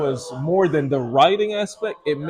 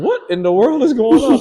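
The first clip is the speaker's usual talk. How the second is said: higher and louder, alarmed; a startled what's going on; alarmed wife; 9 semitones higher, 8 dB louder than usual. Retold or imitated. Wife reacting to his breakdown, alarmed and loud. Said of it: "she is completely like".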